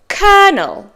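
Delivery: colonel high then falling, KER-nul; correct pronunciation. Shown as a demonstration. Teacher repeats the word for learners, correct pronunciation. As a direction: say colonel clearly as "kernel". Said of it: "but it's colonel".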